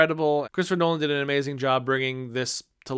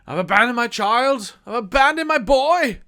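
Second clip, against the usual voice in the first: a much higher, much louder anguished movie yell; Daniel Day-Lewis shouting; 9 semitones higher, 8 dB louder than usual. Retold or imitated. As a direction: belt it out like a tormented film oil man. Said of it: "I can't do it 'cause I'm not Daniel Day-Lewis".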